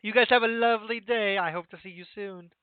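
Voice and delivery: lightly acted cheery goodbye; see the guests off brightly, starting loud; lightly voiced host's send-off; friendly farewell in character. Game master voices character's farewell.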